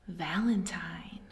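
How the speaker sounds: hushed whispered title. Acted. Teacher whispering the title to little listeners. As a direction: breathe the word like a secret.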